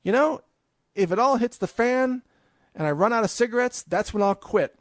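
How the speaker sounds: lightly hammed-up excuse-making smoker; mock addict. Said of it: "So you just figure".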